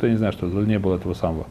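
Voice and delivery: ordinary talk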